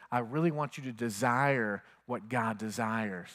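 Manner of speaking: like a loving voice close in your ear; gentle Spirit voice. Acted. The Holy Spirit gently speaking to the believer, soft and slow.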